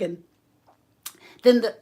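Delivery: ordinary delivery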